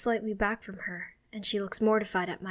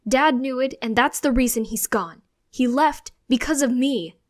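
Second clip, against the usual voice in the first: loud, hurt accusation; angry outburst; 5 semitones higher, 12 dB louder than usual. A narrator's reading. Child blaming self for dad leaving, loud outburst. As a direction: hurl it out, voice raised.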